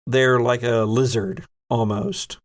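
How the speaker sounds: normal talking voice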